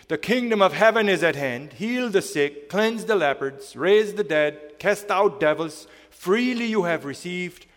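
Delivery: loud, high, full-force preaching. Acted. Preacher proclaiming Jesus' commands to his congregation, loud and ringing. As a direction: proclaim each command like a call to arms.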